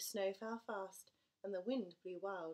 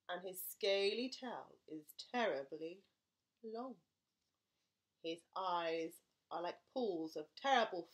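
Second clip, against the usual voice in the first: louder spooky monster-father telling; scary description; 2 semitones lower, 5 dB louder than usual. Acted. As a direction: build the terror of the mouse. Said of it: "Gruffalo describes mouse".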